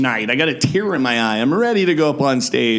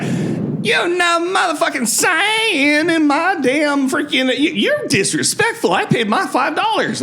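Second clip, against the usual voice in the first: hammed-up furious heckler, pitched way up; mock furious yell. Retold angry woman in audience.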